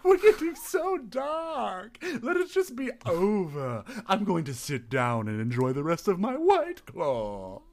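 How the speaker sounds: very high, slow mock-weary host; high slow outro host voice; outro man voice. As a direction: sigh it out like a prissy host. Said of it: "outro man".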